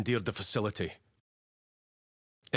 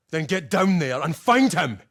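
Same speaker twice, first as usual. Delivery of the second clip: louder, higher, forceful command; angry order; 6 semitones higher, 7 dB louder than usual. Acted. Commander ordering Titus to find Luz, angry shout. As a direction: send him off with force.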